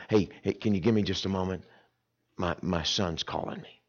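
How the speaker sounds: dropped low and soft, excusing himself; hushed aside. Retold or imitated. Father tells pastor softly he must answer his son. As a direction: quietly step away to take the call. Preacher quotes himself pausing conversation.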